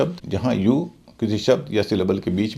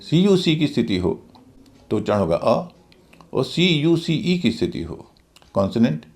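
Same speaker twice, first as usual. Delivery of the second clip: usual speaking voice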